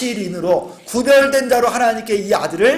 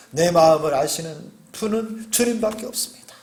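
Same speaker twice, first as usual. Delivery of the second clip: ordinary talk, nothing marked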